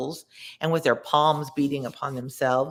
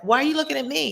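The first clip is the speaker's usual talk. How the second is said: much higher, indignant modern paraphrase; mock sinner; 10 semitones higher, much faster than usual. Reader paraphrasing the sinner in plain English, high mock indignation. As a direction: squeak it out indignantly. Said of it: "He's like".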